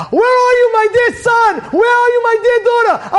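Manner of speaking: high, loud searching cry; high-pitched preacher's shout; impassioned calling. Acted. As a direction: call out fervently to a lost child. God calling to his children.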